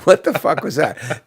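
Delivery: ordinary talk, nothing marked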